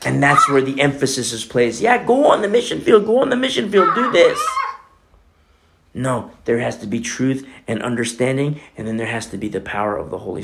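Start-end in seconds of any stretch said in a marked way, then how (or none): 1.8-4.4 higher, laughing mock enthusiasm; a light parody of eager urging; mock eager churchgoer. Preacher mimicking careless mission zeal, higher mocking voice.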